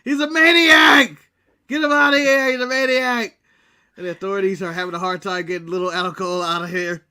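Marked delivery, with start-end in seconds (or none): 0.0-3.4 slow, loud panicked yell, pitched up; hammed-up shouting in mock alarm; imitating Jericho yelling. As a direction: bellow it like a frantic wrestler pointing at a maniac. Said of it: "Jericho's yelling".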